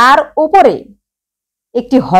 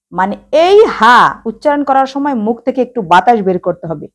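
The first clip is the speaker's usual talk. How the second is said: usual speaking voice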